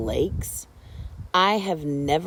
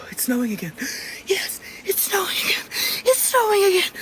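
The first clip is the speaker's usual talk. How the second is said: excited squeal; faster than usual. Real, not acted. Excited at snow.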